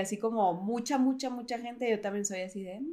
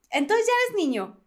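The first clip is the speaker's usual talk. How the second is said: put-on childlike question; 8 semitones higher than usual. Imitating little brother again.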